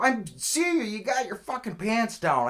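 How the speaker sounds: high, full-volume yell; angry chasing-off shout. Retold or imitated. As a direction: holler to run a trespasser off. Arguing back at caught man.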